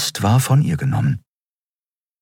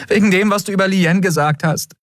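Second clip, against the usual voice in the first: tearful confession. A narrator's reading.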